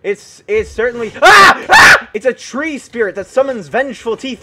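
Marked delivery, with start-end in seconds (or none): 1.2-2.1 two high, very loud yelps; startled shriek. Real, not acted. Gamer attacked by vengeful teeth, startled shriek. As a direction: yelp twice, leaping higher.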